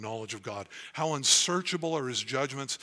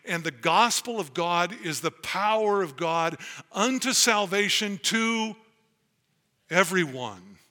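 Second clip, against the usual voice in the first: preaching at full force; 4 semitones higher, 7 dB louder than usual. Acted. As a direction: drive the gospel home to the congregation. Rising preaching climax.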